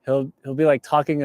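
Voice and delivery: usual speaking voice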